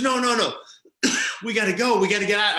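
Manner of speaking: high-pitched mimicked panic; panicky pleading. Lead singer insisting they leave after the crash, panicky. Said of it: "is freaking out".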